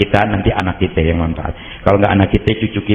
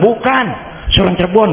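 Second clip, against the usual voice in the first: high, teasing answer; playful retort; 12 semitones higher than usual. Retold or imitated. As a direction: tease them with the punchline. Joking reply to the shamans. (menjawab bercanda)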